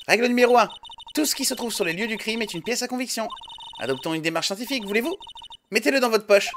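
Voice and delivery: voix perchée de donneuse de leçons; récite les règles d'un ton haut et assuré; jeune enquêtrice scientifique. Acted. (jeune scientifique sentencieuse, voix aiguë)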